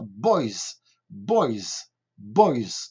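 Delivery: put-on "boys" ending in a buzzing Z sound; slow "boys" with a voiced Z at the end. Shown as a demonstration. Teacher shows the plural S said as Z. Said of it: "il se prononce comme un Z".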